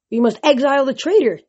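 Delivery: high, forceful insistence; insisted. Acted. Selenius repeating his demand to exile Grover, insisting. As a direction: push the demand hard. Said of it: "Selenius insisted".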